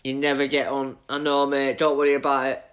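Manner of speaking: ordinary delivery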